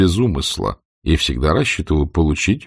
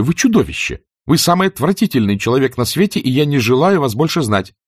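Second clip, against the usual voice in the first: high, heated acted tirade; furious outburst; 7 semitones higher than usual. A narrator's reading. Scarlett stamping at Rhett, furious outburst. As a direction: stamp and let it fly. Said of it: "теряя самообладание, топнула ногой".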